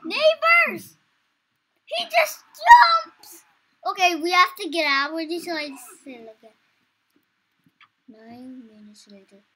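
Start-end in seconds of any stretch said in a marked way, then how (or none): 0.1-3.4 high, loud startled yelling; excited shout. Real, not acted. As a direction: yell it out in alarm. Kid startled by game monster.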